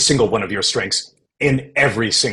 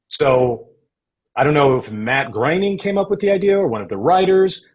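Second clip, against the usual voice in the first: ordinary talk, nothing marked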